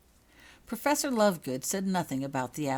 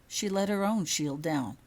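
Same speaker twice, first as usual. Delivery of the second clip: ordinary delivery, nothing marked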